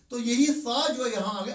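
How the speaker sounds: usual speaking voice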